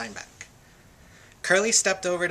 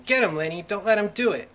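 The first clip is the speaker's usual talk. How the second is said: raised, urgent narrated yell; storyteller acting out a yell; 5 semitones higher, 6 dB louder than usual. A narrator's reading. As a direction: urge him on with a loud, higher voice. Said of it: "George was on his feet yelling".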